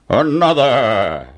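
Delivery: slow, drawn-out giant command; giant's order. A narrator's reading. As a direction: demand it as a giant, stretching it out. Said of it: "ordered the giant".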